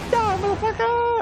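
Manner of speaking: mock action-movie threat; movie-line impersonation. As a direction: bark it like a hammed-up movie villain. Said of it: "it's impersonating a movie line".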